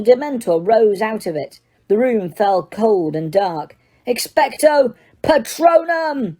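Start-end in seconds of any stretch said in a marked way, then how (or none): 4.1-6.4 high, slow bellow; bellowed spell. A narrator's reading. Harry bellowing the spell at the Dementor. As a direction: bellow the charm with all you have. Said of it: "Harry bellowed".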